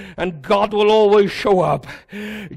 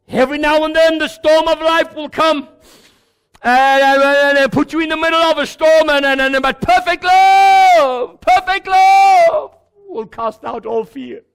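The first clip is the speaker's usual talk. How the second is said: full-force preaching; 5 semitones higher, 4 dB louder than usual. Acted. Pastor declares to the congregation that love casts out fear, shouted. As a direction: proclaim perfect love at the top of the voice.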